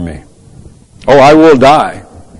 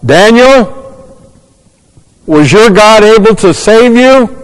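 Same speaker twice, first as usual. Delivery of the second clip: loud, high call across a distance; imitated anxious king's yell; 9 semitones higher, 5 dB louder, slower than usual. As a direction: holler it like a king calling down into a pit. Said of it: "he yells".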